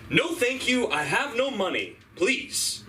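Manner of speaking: high, firm mock brush-off; hammed-up defensive politeness; guarded refusal. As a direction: fend off a stranger with polite alarm. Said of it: "I immediately put up my guard".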